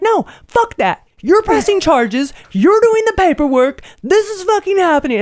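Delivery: re-enacted losing it. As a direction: lay down the law, pitch way up. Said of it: "lose my shit".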